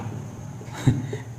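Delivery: brief, breathy laugh under the breath; soft chuckle. Real, not acted. Teacher working a sum for students, soft chuckle. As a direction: let out a quiet little laugh.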